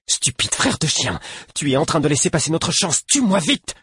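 high-pitched furious urging, climbing to a cry; urgent exhortation. A narrator's reading. Animal begs its brother to kill it, urgent high cry. Beast urges him to kill it.

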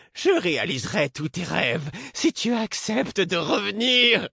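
very high, desperate bargaining voice; high, pleading, stunned woman's voice. A narrator's reading. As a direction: beg him to come back, very high and dazed. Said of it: "l'hébétude et le désespoir".

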